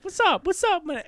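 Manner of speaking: high-pitched swaggering greetings; Chris Tucker. Retold or imitated. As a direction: greet everyone in a high, cocky voice. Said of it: "Chris Tucker's going around. He's like".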